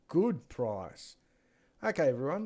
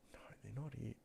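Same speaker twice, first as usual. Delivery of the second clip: whisper; 12 dB quieter, faster than usual. Real, not acted. Muttering to himself while searching.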